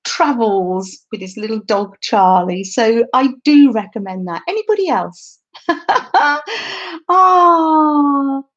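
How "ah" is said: loud high laughter ending in a cry of delight; real laughing fit; laughing hard. Real, not acted. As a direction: crack up at the comment. Laughs at viewer comment.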